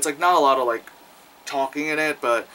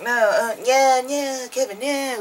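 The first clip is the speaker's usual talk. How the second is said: mock pleasured moan; 10 semitones higher than usual. Acting out guy's pleasure.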